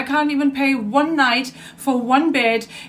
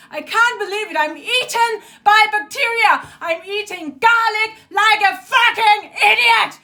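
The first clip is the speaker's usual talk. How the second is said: raised-pitch, furious venting; real anger; 5 semitones higher than usual. Real, not acted. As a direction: rant at the end of your patience. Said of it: "I'm so fucking angry".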